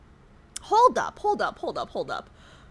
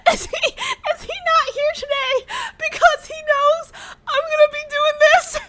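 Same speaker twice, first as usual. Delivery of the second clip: laughing; 12 semitones higher than usual. Real, not acted. Laughing realization mod avoids her.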